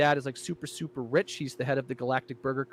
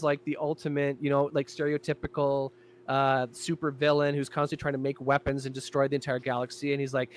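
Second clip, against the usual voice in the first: ordinary delivery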